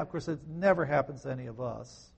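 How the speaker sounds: ordinary talk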